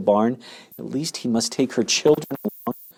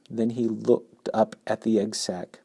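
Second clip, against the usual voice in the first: ordinary delivery, nothing marked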